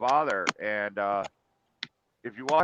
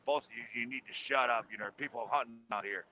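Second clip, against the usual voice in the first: hushed scolding dad; 2 semitones lower, 8 dB quieter than usual. Retold or imitated. Father hushing his arguing sons in hunting woods, hushed scold. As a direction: hiss at the boys to keep it down because hunters are near.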